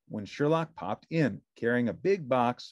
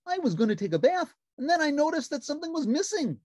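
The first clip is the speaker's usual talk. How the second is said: high, bright puppet voice; cheerful little puppet; Ernie voice; 6 dB louder, faster than usual. A narrator's reading. Narrator voicing Ernie answering the phone, high puppet voice.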